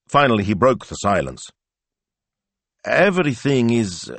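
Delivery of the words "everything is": higher, drawn-out and unfinished; hesitant father feeling his way in. A narrator's reading. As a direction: start carefully, then falter. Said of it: "he hesitated".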